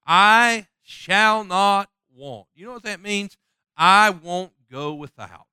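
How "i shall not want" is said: "I shall not want" drawn out very slowly, each word weighted; slow emphatic scripture; slow emphatic quote. Shown as a demonstration. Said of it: "Notice the next phrase, please".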